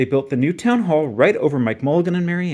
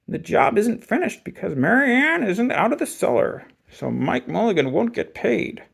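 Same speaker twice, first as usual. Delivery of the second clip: mean sly voice; 7 semitones higher than usual. A narrator's reading. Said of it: "smiled in a rather mean way".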